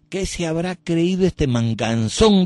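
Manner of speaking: mocking a scornful priest. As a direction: sneer it as the offended priest.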